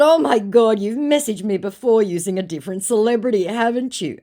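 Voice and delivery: high, loud mock-incredulous retort; indignant callout. Woman confronting a celebrity impersonator in chat, indignant. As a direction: act out an exasperated accusation.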